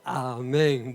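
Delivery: ordinary talk, nothing marked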